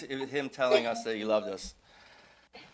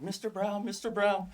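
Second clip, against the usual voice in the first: high-pitched imitation of a pleading boy; pleading kid; 8 semitones higher than usual. Thirteen-year-old apologizing to the other boy's dad, pleading. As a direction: mimic an apologetic kid appealing to an adult. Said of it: "this kid's like".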